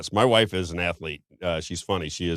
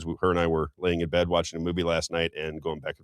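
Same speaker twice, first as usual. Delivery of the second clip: usual speaking voice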